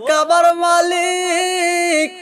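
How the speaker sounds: high, stretched chanted cry; wailing plea. Acted. Grandfather begs God not to hide the boy, wailing plea. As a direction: plead as if wailing to heaven.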